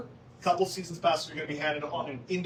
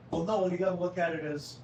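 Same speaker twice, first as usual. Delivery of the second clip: ordinary delivery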